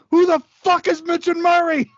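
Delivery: high, loud yelled quote; shouted movie quote. Acted. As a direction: bellow the line. Quoting movie line loudly.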